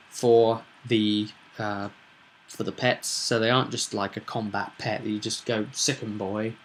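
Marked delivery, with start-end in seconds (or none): none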